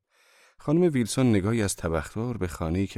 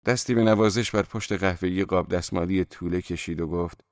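ordinary delivery, nothing marked

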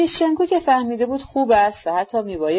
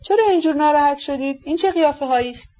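higher, astonished questions, quickening at the end; puzzled surprise; 6 semitones higher than usual. A narrator's reading. Woman wonders why her guests are upset, astonished. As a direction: ask in bewilderment, pitched up. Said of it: "گفتی از هیجان آنها حیران شده باشد".

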